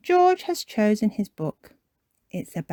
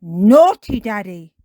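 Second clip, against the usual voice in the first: Peppa Pig; 3 semitones higher, 11 dB louder than usual. Acted. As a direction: scold a parent like a cheeky child. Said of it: "says Peppa".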